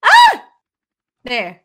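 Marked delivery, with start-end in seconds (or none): 0.0-0.4 short, high, loud shriek; sudden yelp of alarm; startled scream. Real, not acted. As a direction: scream as if about to be hit.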